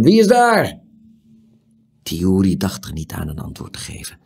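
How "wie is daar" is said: high shouted challenge; loud shout. A narrator's reading. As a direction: call out loudly into the night. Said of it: "riep plotseling een harde stem".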